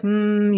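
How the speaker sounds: short voiced "mm" hum; demonstration hum. Shown as a demonstration. Coach showing vibration under the skin, steady hum. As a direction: hum so the vocal folds vibrate. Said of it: "when you make sound, if you can hear like".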